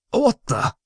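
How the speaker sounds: high-pitched startled splutter. A narrator's reading. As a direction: splutter it in shock. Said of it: "he spluttered".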